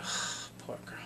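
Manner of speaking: whispered sigh of sympathy; pitying whisper. Real, not acted. Viewer pitying a traumatized victim, soft whisper. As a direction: breathe it out in a sorry whisper. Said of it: "I'm like".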